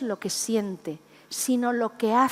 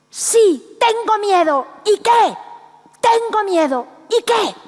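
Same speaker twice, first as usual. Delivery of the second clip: loud, high defiant yell up at life; defiant shout; 11 semitones higher, 8 dB louder, slower than usual. Retold or imitated. Speaker yells her fear up at life: defiant shout. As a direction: shout it at the sky, no shame in fear. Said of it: "le gritaba a la vida".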